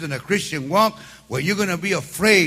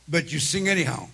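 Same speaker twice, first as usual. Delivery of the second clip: normal talking voice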